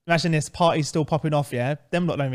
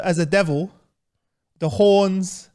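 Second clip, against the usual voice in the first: normal talking voice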